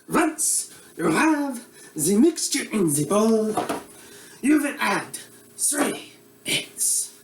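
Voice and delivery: put-on comic Eastern European accent as a bumbling TV cook; broad Russian accent; mock Russian accent. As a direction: play a hammy Russian-accented chef host. Comedic Boris cooking show character.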